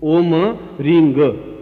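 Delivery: ओम रींग ग spaced out slowly, म and ग each clear; very slow syllables. Shown as a demonstration. Teacher slowly spelling out ओम रींग ग for students. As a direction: spell the bija sounds out one by one. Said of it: "बस ये दो ही चीजें".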